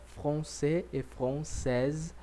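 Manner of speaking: français then française, the z sounded at the end; français with no final sound, française ending in a clear z; French nationality words. Shown as a demonstration. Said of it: "masculino eu digo français".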